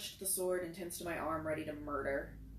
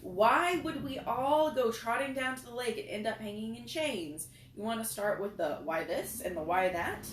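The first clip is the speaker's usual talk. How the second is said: loud, higher, furious rant; angry outburst; 4 semitones higher, 7 dB louder than usual. A narrator's reading. Narrator rounding on Christopher, angry and loud. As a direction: let the anger boil over, louder and higher. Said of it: "suddenly angry".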